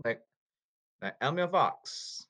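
ordinary delivery

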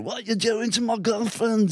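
high, drawn-out mock outburst. Retelling a punk charging at him over his girlfriend, high mocking imitation. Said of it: "And [name] heard it. He came flying".

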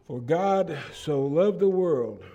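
slow verse reading with "so" leaned on hard; stress on so. Shown as a demonstration. Pastor reads the verse to his congregation, emphasizing "so". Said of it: "I've emphasized the word "so".

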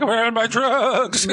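high, slow laughing whine; whiny high laughing wail. Retold or imitated. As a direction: whine like a strung-out mess. Voicing his withdrawn self.

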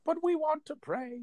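high, slow, pleading whine; whiny pleading voice. Pretend. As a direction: beg like a meek churchgoer. Mock pleading churchgoer.

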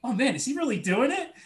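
high, amazed; mock amazed host. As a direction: sound shocked for show. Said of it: "And Craig's like".

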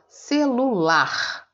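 teacher stretching "celular" to show the strong LAR; stress on LAR. Shown as a demonstration. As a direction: sound out "celular", leaning on final LAR. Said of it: "O lar é mais forte".

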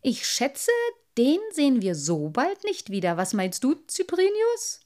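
cheerfully pleased with his trick; cheerful little merman. A narrator's reading. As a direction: lift it into a high question at the end. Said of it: "sagte der kleine Wassermann fröhlich".

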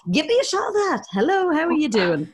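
high, keen chat-up voice; jokily over-keen hello; eager flirting. Retold or imitated. Acting eager flirt with British lads.

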